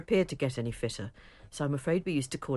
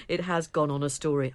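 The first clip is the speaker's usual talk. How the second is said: usual speaking voice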